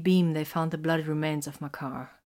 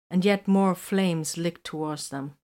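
plain reading voice

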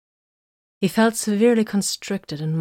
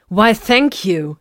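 slow, higher, louder mock thanks; sarcastic thanks, drawn out; 4 semitones higher, 8 dB louder, much slower than usual. A narrator's reading. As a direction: drip sarcasm over it. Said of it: "she began sarcastically".

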